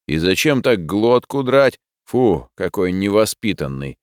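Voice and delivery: ordinary delivery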